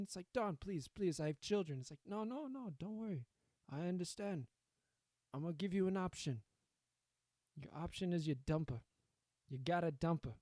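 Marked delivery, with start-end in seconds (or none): 0.3-1.8 higher, hammed-up plea to a mob boss; mock desperate victim; mock begging. Acts out begging the Don. 2.1-10.3 higher, put-on Italian mob boss; hammed-up Don with Italian accent; Italian mobster accent. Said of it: "I can't do an Italian accent".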